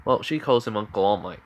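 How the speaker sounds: ordinary delivery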